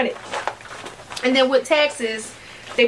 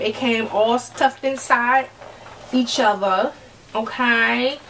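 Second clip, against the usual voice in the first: ordinary talk, nothing marked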